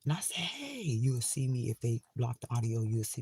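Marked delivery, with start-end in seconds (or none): 0.4-0.8 high hissed hey. Retold or imitated. Retelling her whisper to cellmate.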